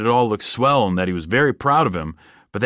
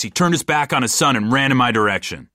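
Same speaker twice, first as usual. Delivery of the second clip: ordinary delivery, nothing marked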